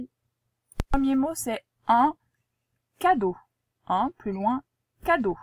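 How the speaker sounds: slow, loud dictation read. Shown as a demonstration. Teacher dictating the first word clearly, slow and loud.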